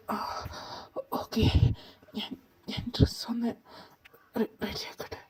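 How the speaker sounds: stammered, breathless whisper; a scared child's hushed stammer; nervous whisper. Acted. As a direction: whisper it, tripping over the words. Nervous kid stammering to adult.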